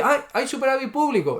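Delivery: sarcastic parody of a vendor reacting; mock naive seller. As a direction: play a gullible shopkeeper discovering a surplus. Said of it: "Creéis que alguien dice".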